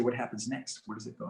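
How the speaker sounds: hushed, patient prompt to a client; calm and patient. Shown as a demonstration. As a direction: ask quietly, leaving room to answer. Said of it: "be calm and patient and slow".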